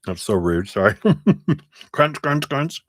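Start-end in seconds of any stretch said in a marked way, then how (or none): 1.9-2.8 high, playful chewing noises; playful crunch sounds. Pretend. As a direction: chant the crunching sound, teasing. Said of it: "I'm crunching my".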